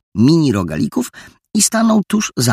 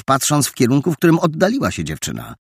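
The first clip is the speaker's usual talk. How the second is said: usual speaking voice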